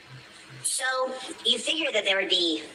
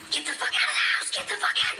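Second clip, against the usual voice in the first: normal talking voice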